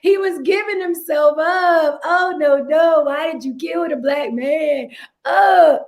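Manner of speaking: high, wailing mock anguish, breaking into a cry; mock distraught cop. Cop mocked wailing over the man just shot. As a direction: ham up a cop's fake grief. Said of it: "the cop is like".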